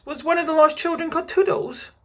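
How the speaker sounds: put-on very high, eager child question; child bursting to know, loud; excited cry. A narrator's reading. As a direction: ask with mounting hope, pitched right up. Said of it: "cried Toodles".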